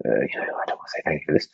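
hushed, fast, grudging whisper; grudging mutter. Pretend. Podcaster mocks gratitude books with a sulky whisper.

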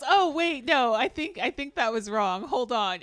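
loud, high mock backpedaling; imitated fluster. Co-host mimicking her after the disconnected number, loud flustered excuse. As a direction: act caught out, scrambling to explain.

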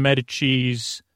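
normal talking voice

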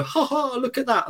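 high, soft jeering ha ha; mock mocking laugh. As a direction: sneer at the poor act. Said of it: "everyone would go".

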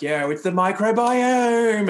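high, slow, knowing pitch voice; mocking a smooth wellness marketer; salesy tone. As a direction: drawl it like you're selling a program. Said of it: "Why are you using that tone?".

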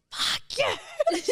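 high, strained mock scream; squealed imitation of screaming, laughing; retold scream. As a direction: shriek it as a reenacted outburst.

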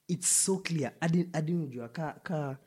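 ordinary delivery

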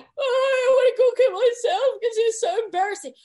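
high, loud, hammed-up despair; mortified wail. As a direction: wail with exaggerated embarrassment to your manager. Retelling her mortified wail to manager.

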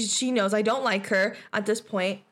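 ordinary talk

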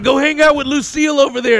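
loud, high mock biker urging; rowdy urging. Bikers send comic to Lucille, mock rowdy urging. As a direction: push them toward it, imitated and boisterous.

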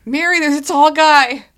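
loud, high shout from a rooftop; high, carrying yell; calling out. Acted. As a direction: holler a warning down to a companion. Calling down from the roof.

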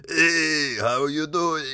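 high, strained alien junk dealer voice; Watto voice. Retold or imitated. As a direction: squeeze it out like Watto. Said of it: "that voice hurts so bad to do".

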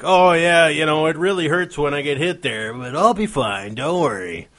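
put-on heroic athlete quote, starting high and settling down; mock-heroic quarterback voice. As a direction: play the brave hurt player brushing it off.

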